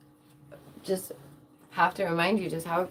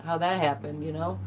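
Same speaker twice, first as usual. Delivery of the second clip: normal talking voice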